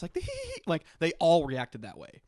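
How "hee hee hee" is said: very high, coy imitated giggle; squeaky mock-shy giggle. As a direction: mimic a submissive tittering laugh. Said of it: "like, the submissiveness".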